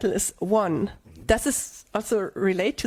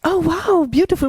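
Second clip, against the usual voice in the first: much higher and louder, thrilled; excited wow; 7 semitones higher, 6 dB louder than usual. Real, not acted. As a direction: burst out admiring it. Sees the tattoo for first time.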